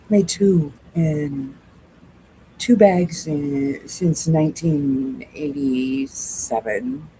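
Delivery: drawn out for comic effect; slow it down, each word weighted; slow emphasis. Real, not acted.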